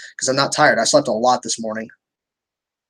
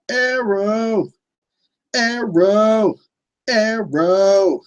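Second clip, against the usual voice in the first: high, drawn-out put-on voice; hammed-up title call; 7 semitones higher, much slower than usual. Streamer answering favorite finale question, hammed-up title call. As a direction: belt out the title in a silly high voice.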